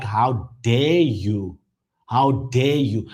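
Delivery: low, drawn-out indignation; acted outrage, very slow and low; indignant rebuke. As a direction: stretch it out as a slow, weighty reproach. Acts out own indignant reply.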